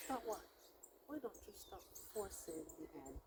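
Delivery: quiet, even husband's voice; a calm husband, quietly; calm retort. Retold or imitated. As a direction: ask it coolly and gently. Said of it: "And he said".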